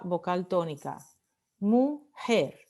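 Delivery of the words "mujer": "Mujer" split into mu, JER; syllable by syllable. Shown as a demonstration. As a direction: break "Mujer" into two beats, stressing the final JER. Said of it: "Última sílaba".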